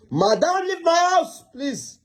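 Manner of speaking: high-pitched, exasperated dismissal; exasperated order. Acted. Husband ordering wife out of his house, high exasperated plea. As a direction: pitch jumps up, then softens on the last word.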